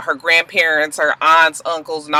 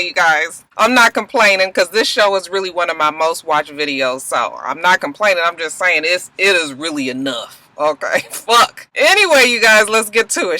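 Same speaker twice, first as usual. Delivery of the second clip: ordinary talk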